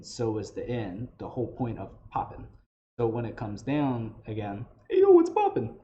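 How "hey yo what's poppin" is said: much higher, louder goblin voice on Hey, yo, what's poppin'; Jabu's voice. Shown as a demonstration. Teacher shows students how Jabu says it, high and loud. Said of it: "you can get into that voice".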